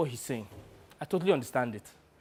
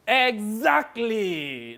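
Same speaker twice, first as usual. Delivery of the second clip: long, drawn-out higher exclamation; Sonny, gleeful agreement; 5 semitones higher, much slower than usual. Acted. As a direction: agree with relish, stretching every syllable. Agent answers the jibe.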